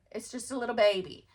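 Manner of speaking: usual speaking voice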